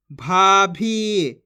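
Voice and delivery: भाभी loud and slow, vowels held; syllables drawn out. Shown as a demonstration. Teacher reads the word, भाभी drawn out. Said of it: "जिस तरीके से मैं खींच खींच के पढ़ रहा हूँ".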